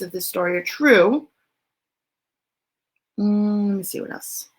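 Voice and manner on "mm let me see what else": plain reading voice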